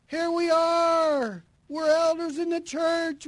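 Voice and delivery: high, loud, slow pompous plea; smug church elders. Acted. Church elders boasting to Jesus about perfect attendance, pompous and loud. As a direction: puff up like self-important elders. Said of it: "What caused that?".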